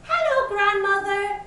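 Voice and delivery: high, lightly sung-out greeting; high little-girl call. A narrator's reading. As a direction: call out sweetly at grandmother's door. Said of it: "she called".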